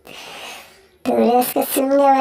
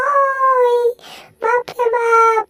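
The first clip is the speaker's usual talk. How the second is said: much higher, panicky yelps; squeaky frightened creature; 9 semitones higher, 4 dB louder, slower than usual. Acted. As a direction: cry out in pain and fright. Frightened high character.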